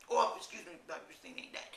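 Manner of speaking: whispered under the breath with a laugh; whispered aside. Real, not acted. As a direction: whisper the correction to yourself.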